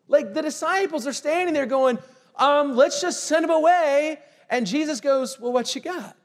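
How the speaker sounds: loud, high preaching push; fired-up preacher calling out his listeners; full-force preaching. Acted. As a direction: drive the point home to the congregation.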